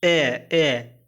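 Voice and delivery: ए drawn out as a clear long "ay"; demonstrating the letter sound. Shown as a demonstration. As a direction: say ए slowly, like a teacher modelling it. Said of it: "ए का उच्चारण". (ए को साफ़ और खींचकर बोलना)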